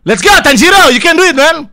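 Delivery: loud, high hype shout; sorakan penyemangat. Real, not acted. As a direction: cheer your fighter on at full volume. Menyemangati karakter Tanjiro. (teriakan semangat bernada tinggi)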